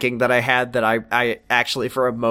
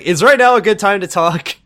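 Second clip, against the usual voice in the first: higher and louder, bright opener; mock eager; 6 semitones higher, 5 dB louder than usual. Retelling Jack interrupting Grace, mock eager. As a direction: barge in cheerfully.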